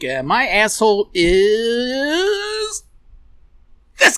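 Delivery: slow, stretched buildup; slow teasing drumroll reveal; drawn-out reveal. Acted. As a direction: stretch it out for suspense. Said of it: "drawn-out reveal".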